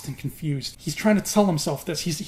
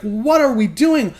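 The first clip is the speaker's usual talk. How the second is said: panicked yell; 9 semitones higher, 5 dB louder than usual. Retold or imitated. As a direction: cry out in disbelief. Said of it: "He's like".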